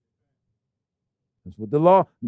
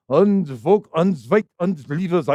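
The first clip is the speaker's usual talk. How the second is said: mock German accent; much slower than usual. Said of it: "that mumbling Fred Flintstone garbage".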